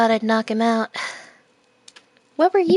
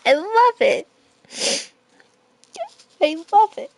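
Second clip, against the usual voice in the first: put-on girl bee voice; 10 semitones higher than usual. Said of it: "I have to sound like a girl bee".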